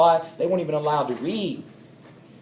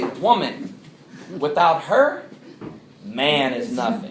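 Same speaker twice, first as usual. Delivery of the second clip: Woman, pause, without her, pause, Man is nothing; women's punctuation; 2 semitones lower, slower than usual. Retold or imitated. Woman repeats the line for the speaker with pauses. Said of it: "And she would say".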